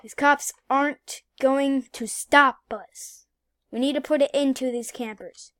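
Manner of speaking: ordinary delivery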